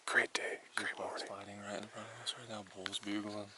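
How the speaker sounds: hushed, thrilled whisper; excited whisper. Real, not acted. Bulls fighting right in front.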